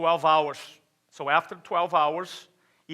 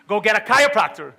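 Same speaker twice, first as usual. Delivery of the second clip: loud, higher, exasperated doctor's order; emphatic retold order; 5 semitones higher, 11 dB louder than usual. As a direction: belt out the advice as the doctor.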